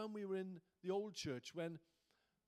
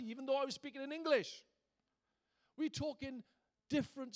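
higher and louder, driving the point home; preaching at full force; 6 semitones higher, 4 dB louder than usual. Acted. Preacher urging the church toward a spiritual walk, fervent and loud. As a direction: rouse the congregation with rising fervour.

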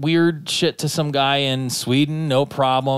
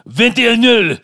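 high, loud mock French accent; angry French buyer leaving feedback, mock French accent; 11 semitones higher, 8 dB louder than usual. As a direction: sneer it in an overdone French accent. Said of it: "And in French".

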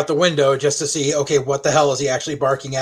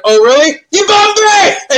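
much higher and louder, slow hammed-up outrage; mock indignant shout; 8 dB louder, slower than usual. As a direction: fake offence bellowed for a laugh. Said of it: "I'm like".

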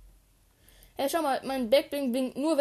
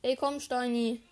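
ordinary delivery

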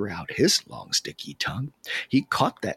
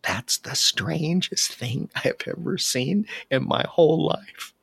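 plain reading voice

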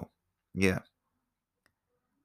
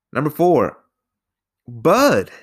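excited; 6 semitones higher, 6 dB louder, slower than usual. Real, not acted. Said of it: "excited list announcement".

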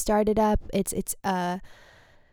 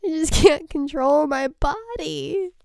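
higher, whiny mock plea through half-tears; mock tearful pleading; 6 semitones higher than usual. Retelling her laughing fit to listeners, a mock tearful plea. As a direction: play it up as a helpless wail. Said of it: "And I'm like".

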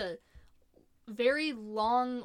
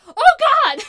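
very high, very loud shocked cry; retold shock, shrieked high and loud; shocked gasp; 21 dB louder than usual. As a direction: caught off guard by a sudden death. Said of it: "And I'm like".

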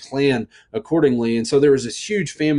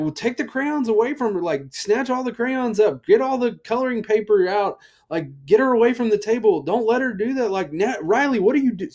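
high, urgent frantic orders; frantic dad; 10 semitones higher than usual. Retold or imitated. Dad panicking to his wife about crayons, frantic. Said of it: "And I was like".

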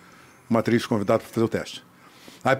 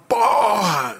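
retold telling-off; 8 semitones higher than usual. Director tearing into the actor at first sight, angry outburst. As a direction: burst out with an irate director's curse. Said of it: "O cara me deu umas porra".

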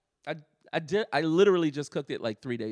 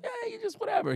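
a squeaky, much higher shrugging dad; mock carefree dad; 4 dB quieter, faster than usual. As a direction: toss it away like a dad who doesn't care. Son imitates dad shrugging off.

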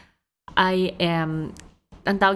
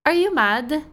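put-on higher child voice asking earnestly; little daughter; 9 semitones higher, 4 dB louder than usual. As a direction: play a curious toddler peering up. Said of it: "When she'd ask kasi".

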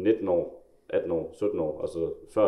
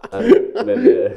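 much higher and louder, laughing; talking through laughter; 9 dB louder, much slower than usual. Real, not acted. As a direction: try to continue while still laughing. Taler videre gennem grinet. (grinende og meget lyst)

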